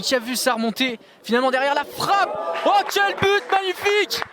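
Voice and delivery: loud, high excited goal shout. Real, not acted. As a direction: scream the goal with delight. Goal call in commentary.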